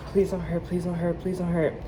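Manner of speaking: softly begging under her breath, retold; a hushed little plea as the needle comes; quiet pleading. Patient pleading with the nurse, quiet and scared.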